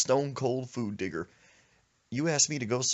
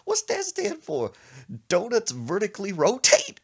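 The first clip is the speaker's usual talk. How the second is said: wailing grandma; 8 dB louder than usual. Retold or imitated. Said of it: "She said".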